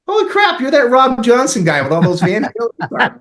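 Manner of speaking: loud, high-pitched astonished recognition; imitating a thrilled, loud double take; starstruck outburst. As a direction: burst out like an amazed fan. Retold astonished recognition by guitarist.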